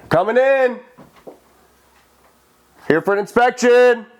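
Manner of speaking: loud high calling out; calling down a hallway. Real, not acted. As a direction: call out to anyone inside. Announcing self in empty house.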